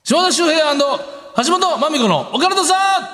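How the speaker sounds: high, bright title announcement; announcer title call. Acted. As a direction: call out the show title with flair.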